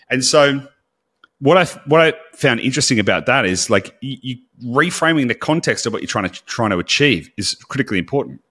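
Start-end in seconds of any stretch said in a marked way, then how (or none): none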